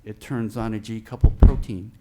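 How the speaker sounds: echoing voice in a big room; booming echo on the mic; big hall echo. Real, not acted. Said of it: "I'm sorry I'm echoing".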